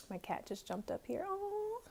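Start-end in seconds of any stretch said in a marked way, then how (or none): 1.3-1.8 high, soft, drawn-out coo. Real, not acted. Owner greeting her cat who jumped up, cooing. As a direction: melt over a pet in a high voice.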